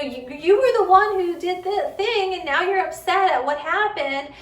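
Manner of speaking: high, loud, fast pleading lecture; over-explaining parent. Retold or imitated. Parent lecturing an upset kid.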